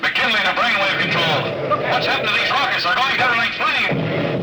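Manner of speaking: high, startled report over the radio; baffled and alarmed; alarmed radio call. Acted. Pilot radioing control as rockets fall, high and alarmed.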